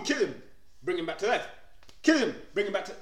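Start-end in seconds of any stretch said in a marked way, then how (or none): none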